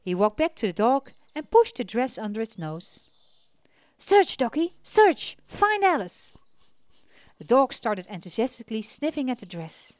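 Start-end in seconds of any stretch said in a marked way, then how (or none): 4.0-6.3 high, loud command to dog. Acted. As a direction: urge the dog on.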